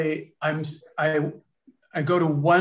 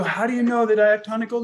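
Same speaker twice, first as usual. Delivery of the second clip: astonished; 6 semitones higher, faster than usual. Retold or imitated. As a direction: blurt the question out amazed. Retold own astonished question.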